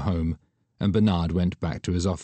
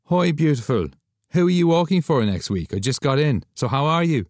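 raised, bright Irish photographer greeting; breezy higher male voice, louder; Douglas, cheerful; 8 semitones higher, 4 dB louder than usual. Acted. Photographer calling his model friend, cheery higher voice.

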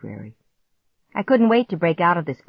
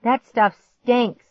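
high, loud cry of disgust; revolted, drawn-out complaint; 7 semitones higher, 6 dB louder, much slower than usual. A narrator's reading. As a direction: recoil loudly from a stink. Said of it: "holding his nose".

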